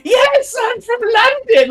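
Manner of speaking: very high, loud, slow hysterical shriek; hysterical answer. Retold or imitated. Cohost imitating Aldous answering Paul Rudd, hysterical high shriek. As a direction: shriek it as if bleeding out. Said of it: "He's becoming hysterical".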